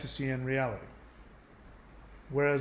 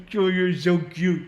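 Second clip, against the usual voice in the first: slow, louder gushing coo; doting parent; 5 dB louder, slower than usual. Retold or imitated. Parents cooing at their angry toddler, slow loud coo. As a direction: stretch it out, adoring the child. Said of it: "And they're".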